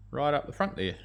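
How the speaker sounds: ordinary delivery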